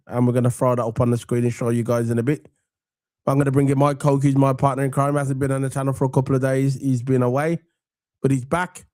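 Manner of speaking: ordinary delivery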